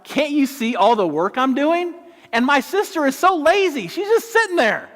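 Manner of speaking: high, whiny complaining sister; high-pitched mock complaint; whiny Martha. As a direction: whine it like an overworked sister. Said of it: "Martha's like".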